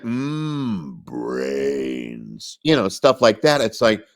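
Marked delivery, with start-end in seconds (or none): 0.0-2.6 zombie voice. Retold or imitated. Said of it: "there were things like".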